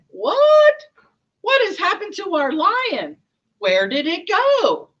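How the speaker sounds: loud, very high shriek of disbelief; shocked outrage. Acted. Poachers finding the empty net, shocked yelling. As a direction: cry out in exaggerated shock. Poachers find the lion gone.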